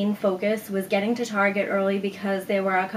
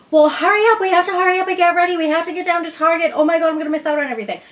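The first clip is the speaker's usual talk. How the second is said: very high, loud, fast frantic rush; 9 semitones higher, 7 dB louder, much faster than usual. Retold or imitated. Urging her mother to hurry to Target, frantic and shrill-high. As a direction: rush mom out the door in a frenzy. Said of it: "freaking out and, like, shaking".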